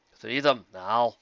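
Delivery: slow, cold command; menacing order. Acted. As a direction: lay down the law, slowly. Medley gives a menacing order.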